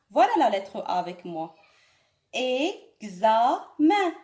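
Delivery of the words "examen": "Examen" stretched out and louder; very slow and loud. Shown as a demonstration. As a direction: drill "Examen" slowly, loudly. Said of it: "تعودوا على نطقه". (نطق بطيء وعالٍ لكلمة Examen)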